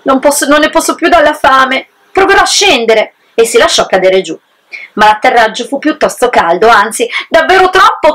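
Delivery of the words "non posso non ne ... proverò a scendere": starving wolf. Acted.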